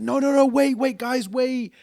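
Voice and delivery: put-on high pleading call of a drunk woman; calling lady. Mimicking the drunk lady calling after them, high pleading voice.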